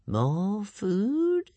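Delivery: high, rising creature voice; musing creature. A narrator's reading. Monster spotting Hiccup, musing to itself in creature voice. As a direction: wonder aloud, stretching the words upward. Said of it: "it mused to itself".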